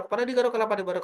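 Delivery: rattled off at speed; fast tongue twister. Shown as a demonstration. Host showing the tongue twister drill at speed, fast. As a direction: race through the tongue twister. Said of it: "Sampai di level terakhir".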